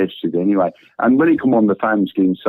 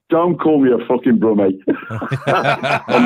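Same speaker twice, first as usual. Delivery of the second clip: laughing; slower than usual. Real, not acted. Laughing at being called brummie.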